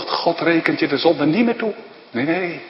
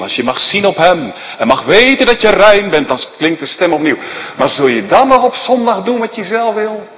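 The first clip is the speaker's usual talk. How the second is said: loud, higher challenging sermon; raised pulpit voice, higher and louder; fervent preaching; 5 semitones higher, 5 dB louder than usual. Acted. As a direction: press the congregation with pointed questions.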